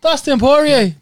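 high, loud New Zealand accent attempt. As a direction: belt out the name in a dodgy New Zealand accent. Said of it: "That was New Zealand".

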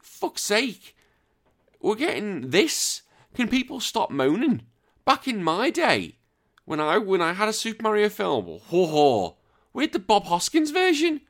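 high-pitched, drawn-out mock-outraged rant; exasperated. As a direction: squeal it slowly in comic disbelief. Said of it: "I was like".

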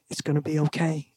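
soft, faraway whisper of reassurance; a quiet inner voice promising it will be fine. Retold or imitated. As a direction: breathe it gently, barely voiced. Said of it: "It was just a whisper".

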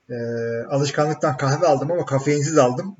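usual speaking voice

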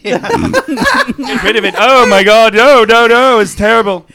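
loud, high, hammed-up alarm; mock panic. Player acting out pleas to dump the cursed item, frantic. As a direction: plead in exaggerated horror.

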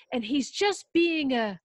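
loud, slow, building to an insult; angry outburst. Retold or imitated. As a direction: let the anger flare, then stop short. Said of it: "I was getting angry".

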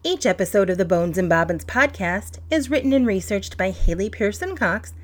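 loud, even podcast outro; announcer read. Acted. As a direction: read it like a show credit.

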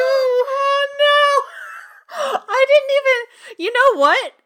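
loud, high wail of amused horror; horrified wail. Real, not acted. Cohost reeling from an unwanted mental image, horrified wail. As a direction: cry out in real, laughing dismay.

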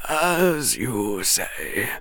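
soft, very slow concession; old headmaster's grudging assent; reluctant. A narrator's reading. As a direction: give in reluctantly, quietly drawn out. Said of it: "Dumbledore said reluctantly".

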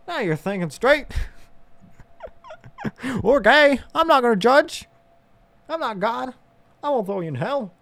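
high, hammed-up preacher; mock preacher voice. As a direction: keep the goofy high pastor going. Continues the mock pastor voice.

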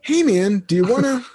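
high, wheedling-light friend voice; Kevin impression. Friend always bringing new ideas, imitated high. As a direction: imitate a friend opening his next pitch. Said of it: "it's basically him going".

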